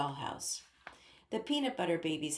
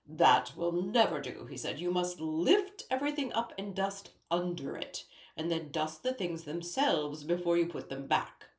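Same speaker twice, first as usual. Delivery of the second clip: a lightly voiced king, louder and scolding; fussy king; 4 dB louder than usual. A narrator's reading. King scolds Ellie on dusting her dresser, louder and fussy. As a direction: instruct her on dusting with royal disapproval.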